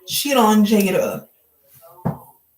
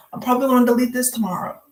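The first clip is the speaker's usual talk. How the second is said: quick quoted aside; much faster than usual. Retold or imitated. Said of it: "She's like".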